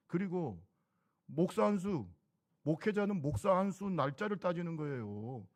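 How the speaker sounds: loud, driving pulpit delivery; preaching at full force. Acted. The pastor presses the congregation on ordination seniority, loud preaching. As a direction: hammer the point home to the congregation.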